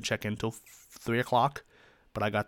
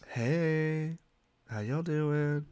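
teacher greeting; 6 semitones higher, much slower than usual. Shown as a demonstration. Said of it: "that's how I address my online students".

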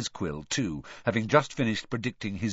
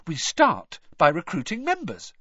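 higher-pitched, pleased announcement; happy; 8 semitones higher, 3 dB louder than usual. A narrator's reading. Hermione explaining her plan to Harry, happily. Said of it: "said Hermione happily".

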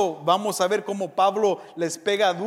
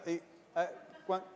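sly bribe; 4 semitones lower, 13 dB quieter, faster than usual. Retold or imitated. Mock bribe attempt again.

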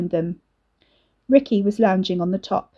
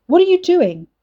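shout; 10 semitones higher, 7 dB louder than usual. Acted. Brother confronts siblings.

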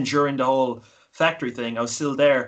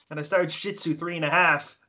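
ordinary talk, nothing marked

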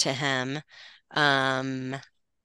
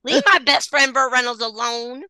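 mock indignant; 8 semitones higher, 4 dB louder than usual. Playfully defending Burt Reynolds.

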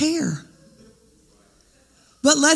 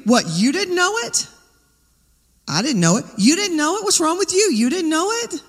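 high, incredulous scolding, pitched up; mock scolding; 7 semitones higher than usual. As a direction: scoff at someone who should know. Mocking scolding of others.

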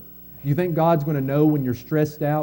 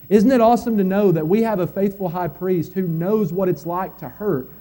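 rising preaching; 6 semitones higher, 6 dB louder than usual. Acted. Pastor lifting the congregation toward hope, building preaching. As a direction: swell it like a sermon climax.

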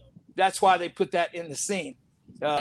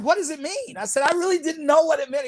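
puzzled; 8 semitones higher than usual. Retold or imitated.

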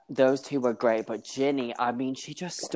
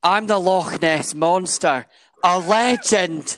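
loud, slow, high Scottish proclamation; emphatic Scottish accent, raised and drawn out; proper Scottish accent; 5 semitones higher, 5 dB louder, slower than usual. Shown as a demonstration. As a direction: declare it grandly in full Scottish. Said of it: "Let me retake that for you".

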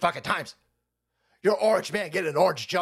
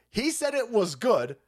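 ordinary delivery

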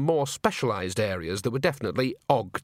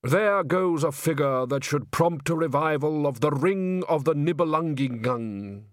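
slow, drawn-out nostalgic reverie; wistful old musician; 4 semitones higher than usual. Acted. Undershaft remembering the great sopranos, wistful. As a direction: linger fondly on each great name.

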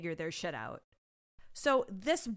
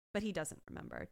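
ordinary talk

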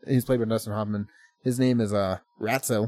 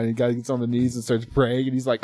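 ordinary talk, nothing marked

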